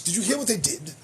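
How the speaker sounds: ordinary talk, nothing marked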